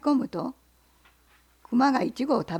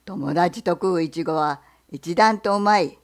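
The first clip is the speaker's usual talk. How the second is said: bear; 4 semitones lower, 4 dB louder than usual. Acted. Bear asks the fox what he sells, low and loud. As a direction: growl the question like a bear.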